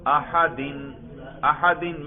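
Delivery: احدن said as "ahadin", ending on a clear "-in"; genitive ending. Shown as a demonstration. Teacher shows students the genitive, احدن as "ahadin". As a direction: give احدن its "-in" case ending. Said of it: "جر، دیکھو سننے سے ہی آپ کو پتا چل رہا ہے".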